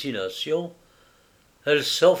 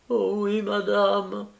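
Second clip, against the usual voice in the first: high, choked sob on the words; a touch of sobbing maid; 8 semitones higher, slower than usual. Acted. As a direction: cry it out through the tears. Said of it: "sanglotait Marianne".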